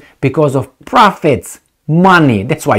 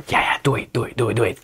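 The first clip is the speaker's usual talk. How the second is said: mock meek Germans; 5 semitones lower, 10 dB quieter, faster than usual. Mocking the Germans' meek answer.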